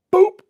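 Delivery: short, popped "boop"; mimicking a sudden squirt; imitated squirt noise. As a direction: pop out a quick comic sound effect. Imitates the brake cleaner squirt.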